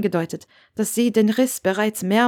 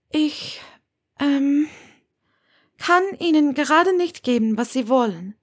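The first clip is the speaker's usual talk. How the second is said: put-on higher, lively young woman's voice; young witch Hermione, defiant; 8 semitones higher than usual. A narrator's reading. Hermione defends her life to Severus, higher and lively. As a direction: speak up brightly for her as she defends her choice.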